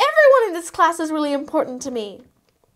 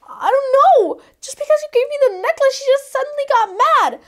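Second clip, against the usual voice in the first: usual speaking voice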